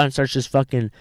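ordinary talk, nothing marked

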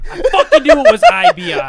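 loud laughing outburst; frustrated shout. Real, not acted. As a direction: yell it in mock fury. Reacting to misspelling.

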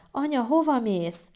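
a touch of tearful pleading; tearful child. Acted. Small child at stairs asking departing mother, tearful. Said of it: "sírva".

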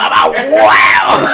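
grito ronco en broma, muy lento. Real, not acted. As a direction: lo suelta gritado y alargado. Grita entre risas. (grita a su amigo en broma)

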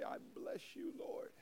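soft whisper over the meal; whispered blessing. Shown as a demonstration. Preacher models a table prayer, whispered softly. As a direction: breathe the blessing quietly.

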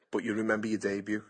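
ordinary talk, nothing marked

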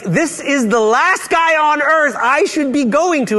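high, slow, emphatic objection; exasperated protest. Acted. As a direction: spell out the objection, drawn out and high. Acted protest of Ananias to God.

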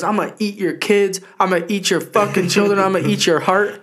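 high, loud Tyson impression; mock Tyson rant. Imitating Tyson threatening an opponent, high and loud. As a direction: rant it in a squeaky put-on voice. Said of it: "He goes".

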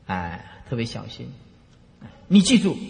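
ordinary talk, nothing marked

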